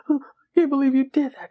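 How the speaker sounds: badly faked, breathy high sobbing; terrible crying. Slapped girlfriend peeking over chair, terrible fake crying. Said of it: "She's crying terribly".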